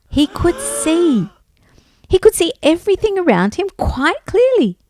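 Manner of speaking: high, excited storytelling; wonder. A narrator's reading. Storyteller reveals that Bart can see, full of wonder. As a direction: reveal the miracle with delight. Narrator tells of the miracle.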